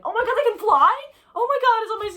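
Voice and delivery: high, loud panicked shriek. Real, not acted. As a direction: squeal in real fright at the bug. Bug flies, she panics.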